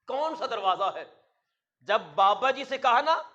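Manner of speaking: ordinary delivery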